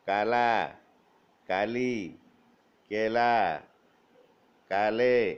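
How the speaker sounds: put-on each word read out loud and separate for children; a teacher dictating words to a class; slow clear dictation. Shown as a demonstration. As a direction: read the list slowly, one word at a time, for pupils to copy. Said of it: "मैं पढ़ता हूँ, ध्यान से सुनो".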